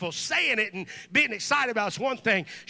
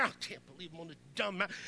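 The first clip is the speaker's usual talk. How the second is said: muttered grumble; 4 semitones lower, 11 dB quieter, much faster than usual. Retold or imitated. Grumbling to himself on mountain.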